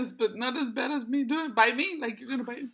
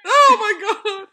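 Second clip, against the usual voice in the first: high loud laughing oh my god; laughing shriek; 10 semitones higher, 13 dB louder, slower than usual. Real, not acted. Laughing at their filtered faces together. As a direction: crack up at the silly face.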